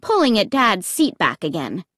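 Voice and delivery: plain reading voice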